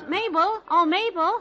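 high, bright call across a crowd. Acted. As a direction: call a friend across the room. Molly calls to her friend.

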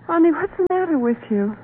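high-pitched anxious plea; alarmed. Acted. Wife pressing husband after his threat, alarmed.

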